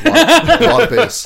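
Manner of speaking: high, loud laughing squeak; cracking up mid-word; laughing hard about the loud bass. Real, not acted.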